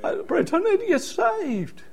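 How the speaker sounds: high, soft, pleading cry to the preacher; a new convert sobbing to the preacher for salvation; tearful plea. Retold or imitated. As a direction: beg to be saved through tears. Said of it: "and I cried".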